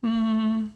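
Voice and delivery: long pondering hum; thinking sound. Shown as a demonstration. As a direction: draw out "mm" as if considering. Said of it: "can you make a thinking sound".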